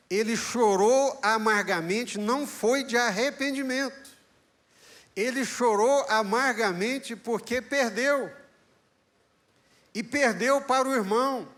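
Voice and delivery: high, loud, emphatic preaching; fervent preaching. Acted. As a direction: drive the point home to the church. Preaching at full force. (pregação fervorosa, alta e enfática)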